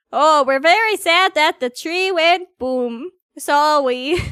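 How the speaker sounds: put-on cutesy apology in a Canadian accent; Canadian-accented baby talk; Canadian OwO. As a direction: round the vowels, small-town Canadian. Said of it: "A Canadian oh-oh".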